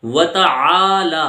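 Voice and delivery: و تعالی slow with ع from the throat; throat ain. Shown as a demonstration. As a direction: stretch تعالی, long aa. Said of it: "اس کا صحیح تلفظ دیکھیے".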